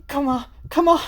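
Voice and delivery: high, tense pleading to herself; desperate urging. Acted. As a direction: force yourself through the fear. Senri voiced.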